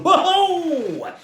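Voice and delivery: very high, loud whoop with a laugh; startled holler. A narrator's reading. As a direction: yelp as you duck. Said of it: "he hollered".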